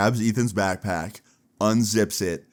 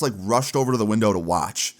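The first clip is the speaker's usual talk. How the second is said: usual speaking voice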